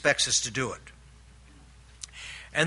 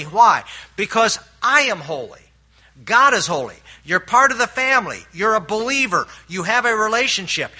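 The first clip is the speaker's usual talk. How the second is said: higher and louder preaching with punched phrases; fervent preaching; 3 semitones higher, 3 dB louder than usual. Acted. Preacher telling believers they have changed masters, fervent and loud. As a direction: build the congregation up with rising conviction.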